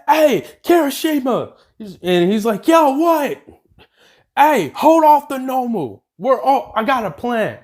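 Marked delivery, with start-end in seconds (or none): 0.1-1.5 high-pitched call to a friend. Retold or imitated. Heroine hailing a teammate during a fight, calling out. 2.7-3.4 high, punchy acted holler; calling back. Teammate answering the heroine mid-fight, calling back. 4.4-7.6 high-pitched urgent call; calling out orders. Retold or imitated. Heroine directing a teammate against a monster, calling out.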